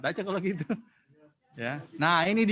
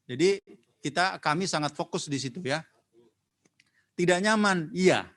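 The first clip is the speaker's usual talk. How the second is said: normal talking voice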